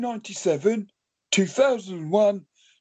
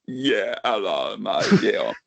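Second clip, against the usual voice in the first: much lower, croaky creature voice; Clayton puppet voice; 12 semitones lower than usual. Pretend. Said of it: "Clayton's back".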